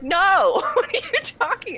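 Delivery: loud, high retold protest breaking into laughter; exasperated outburst. Daughter replays arguing with mom over the gorge, exasperated high outburst. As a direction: cry out in laughing disbelief at mom.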